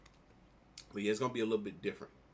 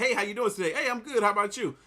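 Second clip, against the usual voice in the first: higher, sing-song fake pleasantries; mock cheerful greeting; 9 semitones higher, 4 dB louder, faster than usual. Mimicking people's fake cheerful greetings, higher and chirpy. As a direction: parody the fake I'm-good chatter.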